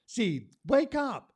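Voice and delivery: high, loud call to wake the seed. Acted. Poet calling the seed to wake, high ringing shout.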